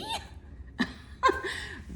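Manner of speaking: a big high mocking laugh; lion's roaring laugh. Lion laughing at the mouse, high mocking laugh. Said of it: "roared the lion, laughing".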